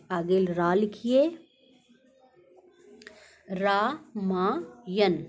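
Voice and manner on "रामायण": रामायण very slowly as "raa, maa, yan"; syllable by syllable. Shown as a demonstration. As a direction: break रामायण into three drawn-out syllables. Teacher sounding out a word to write.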